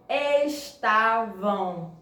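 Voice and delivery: "estavam" stretched slowly, syllable by syllable, TA held; stress on penultimate syllable. Shown as a demonstration. Teacher completes the sentence for pupils, slow syllable-by-syllable stress. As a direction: sound out "estavam" very slowly as es-TA-vam. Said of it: "quando a sílaba forte é a penúltima".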